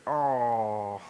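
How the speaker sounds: long, low, falling "aww"; a drawn-out coo at something cute; adoring aww. Shown as a demonstration. Leading the audience in an aww over the precious little sister, adoring aww. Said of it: "everybody say".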